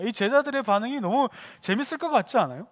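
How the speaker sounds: ordinary talk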